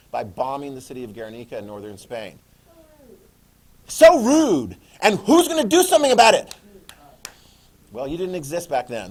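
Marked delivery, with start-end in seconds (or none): 3.9-6.5 high, loud indignant outburst; loud hammed-up challenge; mock outrage. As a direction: call it out loudly, pitch way up. Acting outrage at the violations.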